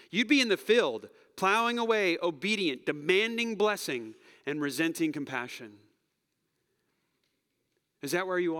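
loud, rising preacher's cry; preaching at full force. Real, not acted. Pastor pressing the congregation, rising to a loud preaching cry. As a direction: push it out to the whole church.

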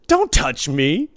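loud, slow, stretched warning; angry warning. Retold or imitated. Dealer acting out the player shoving him, angry warning. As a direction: imitate an aggressor playing the victim.

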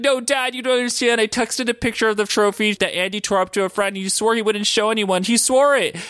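put-on high, whiny pleading child; freaking-out kid. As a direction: imitate a kid protesting in a panic. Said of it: "Danny's kid starts freaking out".

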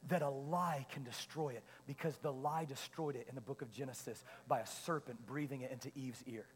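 quiet, weighty divine reply; voice of the Lord. Retold or imitated. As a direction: speak as God, solemn and hushed. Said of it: "And the Lord goes".

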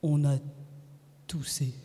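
low, halting answer; low-pitched, deliberate husband voice; husband's teasing reply. A narrator's reading. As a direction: let it out low, in pieces. Husband teases with slow answer.

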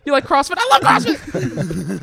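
very high, loud hammed-up gushing; mock over-the-top enthusiasm. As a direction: squeal it like a parody superfan. Acts out hyped questions and answers.

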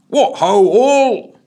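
high, loud jolly policeman; cheery constable. A narrator's reading. Jolly constable bursting in on the PM, cheery. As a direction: boom a cheery greeting at the room. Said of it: "with a cheery".

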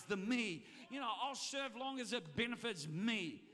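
loud, emphatic pulpit delivery; preacher pressing the congregation; preaching. Real, not acted. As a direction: drive the point home to the crowd. Preaching at full force.

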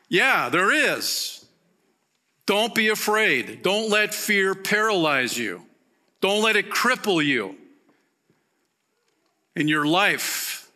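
high, loud, urgent preaching voice; impassioned sermon climax; preaching at full force. Acted. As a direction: drive the warning home from the pulpit.

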